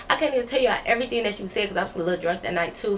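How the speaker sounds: ordinary delivery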